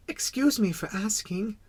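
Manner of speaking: put-on high, polite child voice; venturing little prince. Little prince begging the king's pardon, tentative. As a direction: ask permission timidly, high-voiced. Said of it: "he ventured".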